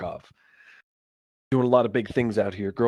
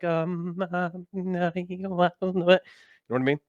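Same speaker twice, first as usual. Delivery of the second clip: higher, halting mock mumble; mock tongue-tied; 7 semitones higher, slower than usual. Asked to do the accent on demand, feigned flustered mumbling. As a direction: fumble it like someone put on the spot. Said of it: "but you ask me to do it, I'll be like".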